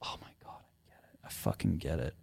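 soft, breathless whisper; hushed whisper of revelation; whispered realization. Retold or imitated. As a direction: whisper it in awe. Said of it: "And then I just went".

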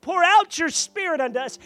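loud, high plea; a crowd of pilgrims begging heaven, loud high plea; crying out. Acted. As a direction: call out for the Spirit to be poured out. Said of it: "And they're crying out".

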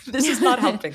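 high, loud protest through laughter; laughing protest. Retold or imitated. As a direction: push it up and out while laughing. Repeats retold complaint while laughing.

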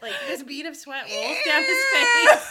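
laughing through the words; loud, slow, cracking with laughter. Real, not acted. Disgusted laughing retelling.

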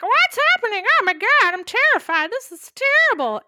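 very high mock-panicked squeal. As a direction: flap about like a terrified little hen. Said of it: "And she's like".

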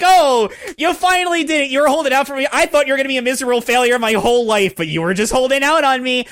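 hammed-up gruff father bragging about his son; imitating the dad. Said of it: "he's like".

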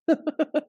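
a quick high burst of laughter; short real laugh. Real, not acted. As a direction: laugh despite yourself at a grim thought. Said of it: "I don't know why I'm laughing".

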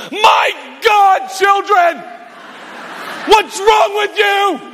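acted furious parent shout; exasperated parental yell. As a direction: blow up at your children, high and stretched. Acted father exploding at his children.

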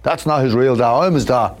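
dad lightly imitated piping up aloud; mild mock call-out. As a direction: announce it out loud, half-hearted. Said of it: "saying out loud in the theatre".

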